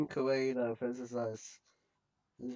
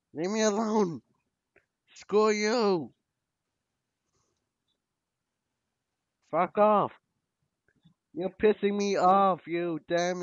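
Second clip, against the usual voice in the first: loud, frustrated yells at the screen; real gamer rage, yelling; angry yelling; 3 semitones higher, 7 dB louder than usual. Real, not acted. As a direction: shout your frustration at the game. Fighting enemies in game.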